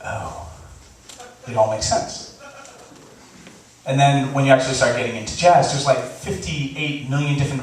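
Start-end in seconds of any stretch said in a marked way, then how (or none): 0.0-2.4 quiet realization. Pretend. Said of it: "you're like".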